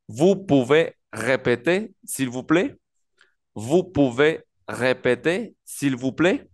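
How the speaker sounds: slow, in separate chunks, rising at the end; slow model question. Shown as a demonstration. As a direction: model a polite French question for learners. Teacher models a French question slowly.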